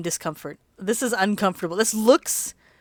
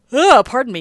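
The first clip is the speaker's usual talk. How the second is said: words stretched by a late-night yawn; 5 semitones higher, 9 dB louder than usual. Real, not acted. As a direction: talk through a real yawn. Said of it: "yawning, because two thirty".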